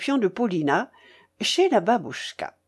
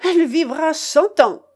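high, loud cry; shrieked taunt to the general; shouted parting shot; 8 semitones higher, 6 dB louder than usual. A narrator's reading. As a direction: call it out tauntingly on the way out. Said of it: "sortit en criant au général".

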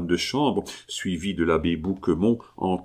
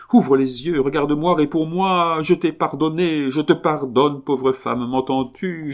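higher and louder, begging her to answer; frantic plea to a wife who won't wake; desperate pleading; 8 semitones higher, 4 dB louder than usual. Acted. Husband begs his dead wife. (le mari supplie sa femme, voix aiguë et forte)

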